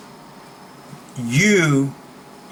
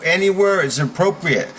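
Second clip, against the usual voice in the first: usual speaking voice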